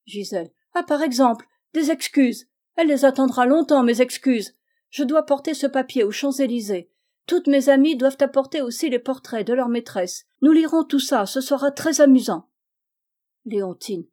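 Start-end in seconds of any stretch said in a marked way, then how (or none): 0.7-12.5 higher, louder brat voice; defiant child. Acted. Spoiled girl scoffs at her governess, loud and high. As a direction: toss it off with haughty glee.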